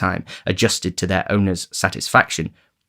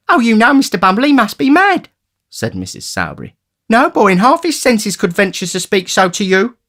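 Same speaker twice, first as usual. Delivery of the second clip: high, fussing woman; high, flustered undertaker's wife; Mrs Sowerby; 13 semitones higher, 6 dB louder than usual. A narrator's reading.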